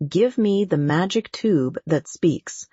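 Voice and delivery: usual speaking voice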